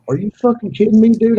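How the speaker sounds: retold angry outburst. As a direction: snap back at the guy who cut your line, half-acted. Retold confrontation with fishermen.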